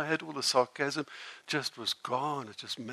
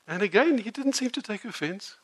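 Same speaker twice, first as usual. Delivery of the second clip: ordinary delivery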